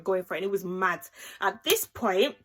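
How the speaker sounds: usual speaking voice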